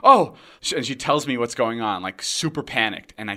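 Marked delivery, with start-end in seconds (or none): 0.0-0.3 sudden high, loud mock-frightened "oh"; scared gasp. Girl snapping out of excitement, scared. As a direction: imitate excitement dropping into alarm. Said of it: "like, really scared".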